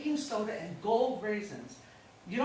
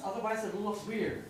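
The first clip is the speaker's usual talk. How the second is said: normal talking voice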